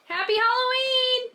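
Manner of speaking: high, drawn-out cheery greeting; excited. Real, not acted. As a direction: sing it out bright and high. Greeting child on Halloween.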